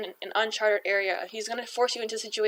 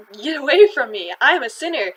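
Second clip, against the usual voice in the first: louder, distressed plea; pleading outburst; 4 semitones higher, 9 dB louder than usual. Retold or imitated. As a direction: push him away in shame. Simon begs Jesus to leave.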